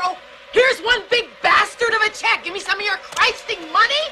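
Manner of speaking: furious mock of her own swearing at the bank; mock crude shout. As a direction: hurl the profane words in mock disgust. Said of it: "do I tell Missus Bollinger".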